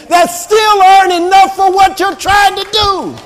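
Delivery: full-force preaching, pitched high; shouting preacher. Real, not acted. Preacher urging the congregation to trust God, shouting. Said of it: "I'm shouting and screaming and spitting and turning red".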